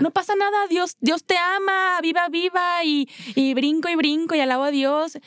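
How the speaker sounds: high, bright mock-happy brush-off; fake cheerfulness. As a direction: parody forced joy, pitched well up. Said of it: "con esta alegría irreal". (alegría falsa exagerada, en tono agudo)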